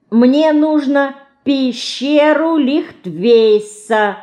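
drawn-out, laborious enunciation; labored pronunciation. A narrator's reading. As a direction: struggle through a difficult name, word by word. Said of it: "с трудом выговорила она".

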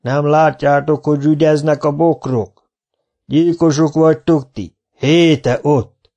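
put-on high, loud, scolding old teacher; shouting. Old teacher scolding the workers, loud and high. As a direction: shout them down in disbelief. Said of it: "kiabált".

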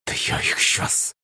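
slow, held-back threat; seething through teeth. A narrator's reading. Yulenka ready to break her tormentors, seething. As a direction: force it out slowly through clenched teeth. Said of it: "процедила она".

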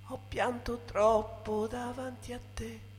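ordinary talk, nothing marked

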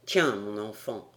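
low, soft, fatherly voice; kindly gentleman. Acted. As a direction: speak low and gently to a child. Glenarvan gives the boy a book. (voix basse et bienveillante)

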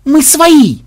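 high, loud protest; frantic yell. A narrator's reading. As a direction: shout it out in a panic to be spared. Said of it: "завопил программист".

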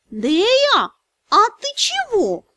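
high voiced dragon spirit, puzzled.

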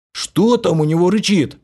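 high, eager question. A narrator's reading. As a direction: ask greedily. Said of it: "жадно спросил".

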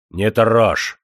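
loud, firm command; stern warning. A narrator's reading. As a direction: warn him off the old woman. Demidov shields the old woman. (твёрдый, громкий запрет)

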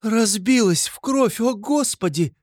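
very high, drawn-out exclamation; horrified cry. A narrator's reading. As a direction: cry out in horror over the fallen woman. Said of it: "вскрикнула Соня". (вскрикивает в ужасе, очень высоко)

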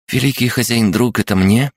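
high, chirpy little creature voice; chirping creature. Acted. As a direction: chirp it like an excited gremlin. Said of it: "прощебетал он". (писклявое щебетание крабогнома)

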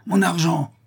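high, sudden outcry; crying out. A narrator's reading. Prisoner seizing the bailiff by the throat, crying out. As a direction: cry out a furious demand. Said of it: "en s'écriant".

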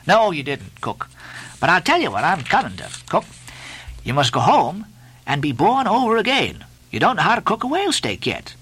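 high, emphatic scolding; Stubb, lecturing mate. A narrator's reading. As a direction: lecture the cook with mock severity.

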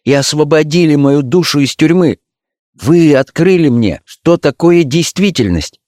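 a lightly voiced young actress, rapt and tender; Sibyl in love. A narrator's reading. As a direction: speak as a girl newly in love, eagerly. Сибилла говорит с Дорианом. (влюблённая Сибилла пылко говорит Дориану)

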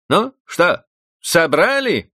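high, loud demanding; stern housekeeper. Acted. Housekeeper to girls, stern demand. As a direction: bark at the girls.

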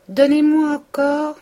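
drawn-out character voice; Binet ordering. A narrator's reading.

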